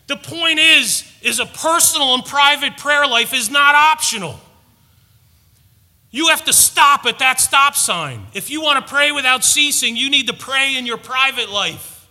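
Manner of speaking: loud, pressing preacher voice; a pastor hammering his point, loud and urgent; fiery preaching. Acted. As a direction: drive the point home to the congregation. Preaching at full force.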